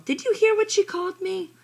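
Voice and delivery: high, loud, aggrieved complaint; whiny wail. A narrator's reading. Megan complaining to Mom about the insult, loud high wail. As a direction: keep wailing the complaint at Mom. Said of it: "I wail".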